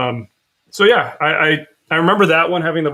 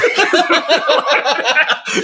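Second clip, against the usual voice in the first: laughing; 12 semitones higher, 4 dB louder than usual. Real, not acted. Laughing at drinking joke.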